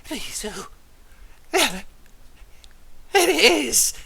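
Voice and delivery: rising to a high loud cry of wonder; the demon spotting his prize; entranced Voskar. Acted.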